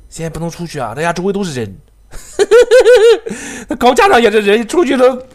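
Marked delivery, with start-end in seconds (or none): 2.1-3.6 loud, high helpless laugh; burst of laughter. Real, not acted. Gamer surrounded by enemies bursts out laughing. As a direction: crack up at the hopeless spot.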